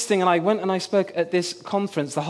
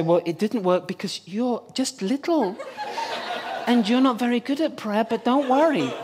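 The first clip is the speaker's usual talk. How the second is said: patronizing; 3 semitones higher than usual. Pretend. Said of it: "I can sort of patronize you and say".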